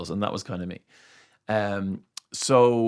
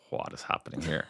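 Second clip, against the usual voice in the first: soft whispered aside, lower; confused whisper; 4 semitones lower, 8 dB quieter than usual. Retold or imitated. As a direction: whisper it so they can't hear. Confused aside during interview.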